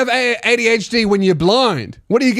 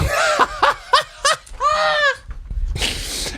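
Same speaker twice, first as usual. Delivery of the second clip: high, helpless cackle; real laughter; 4 dB quieter than usual. Real, not acted. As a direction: burst out laughing in a long, high peal. Laughing at the Lego detail.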